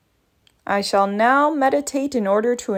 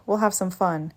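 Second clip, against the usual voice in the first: ordinary talk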